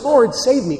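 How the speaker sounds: desperate cry. Retold or imitated. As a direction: cry out as if sinking. Peter crying out sinking.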